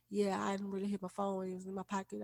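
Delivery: meek, very soft and fading to almost nothing; small, timid murmur, shrinking away. Retold or imitated. Cashier answering the pushy man at her register, meek and very soft. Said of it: "her voice was real meek".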